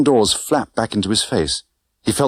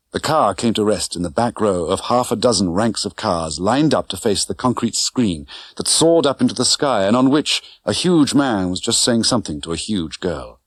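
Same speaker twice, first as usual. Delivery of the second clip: ordinary delivery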